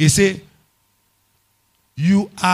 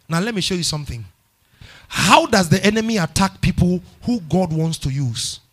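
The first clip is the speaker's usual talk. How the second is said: usual speaking voice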